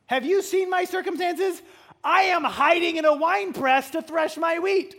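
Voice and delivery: loud, high complaining; whiny protest. Acted. Gideon protesting to the angel, high loud whine. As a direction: plead your weakness loudly.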